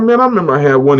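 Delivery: a hint of a New York boss reminiscing; New York accent. Old New York boss recalling his car, light New York accent. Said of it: "He had this New York accent".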